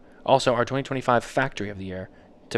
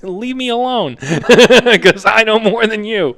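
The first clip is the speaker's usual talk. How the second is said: laughing retort; 10 semitones higher, 10 dB louder, slower than usual. Retold or imitated. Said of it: "and I'm like".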